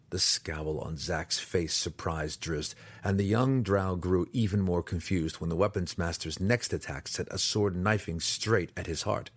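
ordinary delivery

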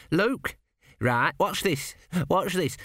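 high, bouncy lad showing off a joke; a keen young apprentice pleased with his pun; eager young apprentice. A narrator's reading. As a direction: chuckle as you set up the gag. Gurn joking eagerly.

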